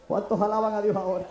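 loud, rising praise call to the congregation; preaching at full force. Real, not acted.

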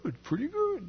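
put-on high, soft little old man voice; Bob. Homeless old man answering a greeting, high little voice. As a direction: pipe up softly, high-pitched and kindly. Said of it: "He's like".

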